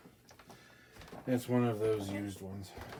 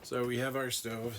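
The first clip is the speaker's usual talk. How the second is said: usual speaking voice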